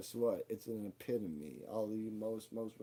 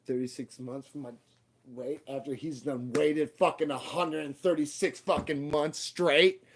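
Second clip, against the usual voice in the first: loud, rising yell on every fucking; furious outburst; 5 semitones higher, 9 dB louder than usual. Real, not acted. Grieving son yelling about the endless dialysis wait, furious. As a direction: spit the words out in rage.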